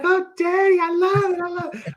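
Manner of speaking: put-on very high, girly squeal of delight; gushing teen daughter. Daughter seeing the convertible, squealing high to her father. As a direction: play the spoiled daughter begging daddy. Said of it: "and she's like".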